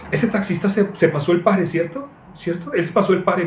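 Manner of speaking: high-pitched, scandalized tattling; mock alarmed student. Preacher imitating a student driver startled by a taxi, high indignant voice. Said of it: "¿Y sabe cómo decía?".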